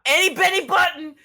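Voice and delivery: high, loud holler; calling out. Acted. Pizza man summoning the boss for a captive, calling out. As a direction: call the boss out from the back.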